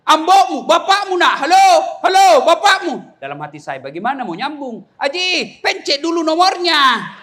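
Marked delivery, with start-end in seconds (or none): 0.1-2.9 loud, high-pitched comic bellow; imitated villager hollering hello on the telephone; shouting into phone. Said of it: "lalu dia berteriak". 5.0-7.1 high-pitched imitated call to Haji; raised, high storytelling voice; mock calling out. Calls out correction to old man.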